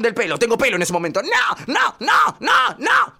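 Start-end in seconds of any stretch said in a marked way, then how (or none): 1.2-3.2 high, loud rising shrieks; screaming no. Acted. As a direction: yell it as your hair gets pulled. Le tiran del pelo. (gritos agudos de nene)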